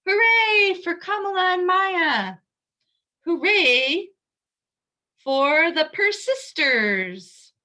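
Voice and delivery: loud, high cheer for Kamala and Maya; cheering hooray. Acted. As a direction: cheer them on with joy. Cheering the girls at the end.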